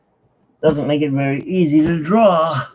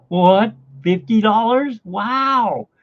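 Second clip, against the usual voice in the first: higher, louder, excited what and wow; like the numbers just jumped out at you; excited disbelief; 9 semitones higher, 4 dB louder, much slower than usual. Retold or imitated. Telling a friend on the phone about the scratcher, high excited disbelief. Retelling finding a fifty.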